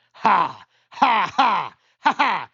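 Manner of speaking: loud, hollow ha-ha bursts; eerie forced laughter. Acted. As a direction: laugh like a man who knows he is doomed. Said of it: "a low laugh that erected the hairs".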